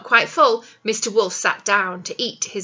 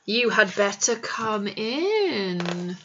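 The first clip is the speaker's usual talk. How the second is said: slow, low sly invitation; sneaky wolf welcoming his victims; 4 semitones lower, 4 dB quieter, much slower than usual. Acted. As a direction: lure them in slowly.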